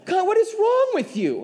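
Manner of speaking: high, loud, playfully scandalized question; mock outrage. Pastor retelling a chat with his wife, mock outraged.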